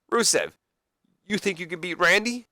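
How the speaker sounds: lively moderator. Acted. Voices Piper as moderator.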